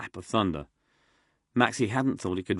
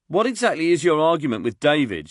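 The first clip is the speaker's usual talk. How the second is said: louder, higher call across the camp; 8 semitones higher, 9 dB louder than usual. Acted. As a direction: call it out over the rain. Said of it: "she called out".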